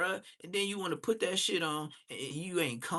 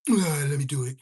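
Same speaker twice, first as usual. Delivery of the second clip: mock King A K; 7 dB louder than usual. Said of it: "he just be like".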